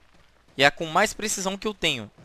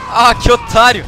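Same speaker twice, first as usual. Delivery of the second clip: high, loud insulting yell; angry shout; 6 semitones higher, 14 dB louder than usual. Real, not acted. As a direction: bark the insult at the enemy. Insulting an enemy in game.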